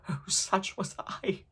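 high, soft, slow lament; hushed, drawn-out self-reproach; remorseful echo. A narrator's reading. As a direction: sink into a softened wail of regret. Ghost's remorseful echo of itself.

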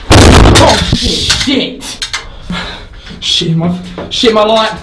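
loud high frightened cries; panicked shouting. Real, not acted. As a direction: panic as the light dies. Light goes out, panic.

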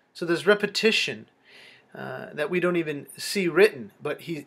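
normal talking voice

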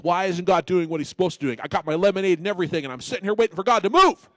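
loud, fast mock whine at God; mocking a sulky believer waiting on God; whiny complainer. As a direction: ham up a sulky complainer demanding God act. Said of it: "and you're like".

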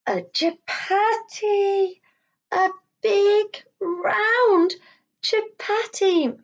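slow, high, wondering; delighted reveal. Shown as a demonstration. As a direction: announce it with delight. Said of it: "triumphant reveal to children".

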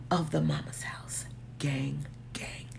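soft whispered gang gang; hushed crew chant; whispered shout-out. Acted. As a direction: whisper it like an inside joke. Channel sign-off to the community.

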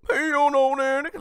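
high-pitched, loud put-on yell; high mock shout. Host heckling in mockery, high mock shout.

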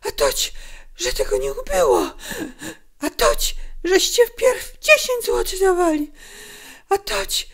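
loud, high, breathy protest; agitated, strained outburst; crying out. A narrator's reading. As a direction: cry out against being cheated. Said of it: "krzyknęła".

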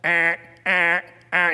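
mock alarm buzz on ah ah ah; a rough vocal alarm sound; fire alarm imitation. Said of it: "hearing a sound like".

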